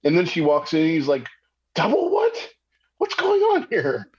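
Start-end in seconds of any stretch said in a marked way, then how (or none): 1.7-4.1 very high, incredulous squeak; acted bewilderment. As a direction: play completely thrown, pitch way up. Freeze confused as she walks in.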